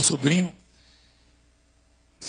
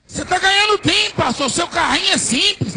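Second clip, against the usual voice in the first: raised, higher reporter voice; pestering interviewer; 9 semitones higher, 4 dB louder than usual. Retold or imitated. TV crew teasing the pastor about his car, pestering interviewer. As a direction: needle him loudly, pitched up.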